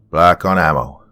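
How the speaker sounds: forceful report to the squad; Hilliard's yell. A narrator's reading. Hilliard reporting he is out of ammo, yelled. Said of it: "yelled Hilliard".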